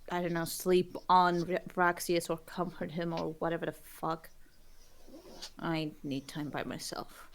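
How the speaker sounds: soft whispered aside, almost breathless; hushed whisper. Acted. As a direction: murmur it low so nobody hears. Viola quietly sends Ruben off.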